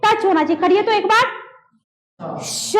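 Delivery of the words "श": "श" as long friction clear of the teeth; palatal sh. Shown as a demonstration. Teacher warning against touching teeth, long palatal "श". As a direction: let air rush through "श", tongue off the teeth. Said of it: "जीभ कहीं touch नहीं होना चाहिए".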